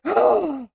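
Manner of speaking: a sudden high gasp of delight; sharp surprised gasp. Real, not acted. Streamer spots a beloved discontinued snack, sharp high gasp. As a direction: gasp as if spotting a lost treasure. Sees a nostalgic snack listed.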